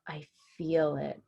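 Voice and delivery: plain reading voice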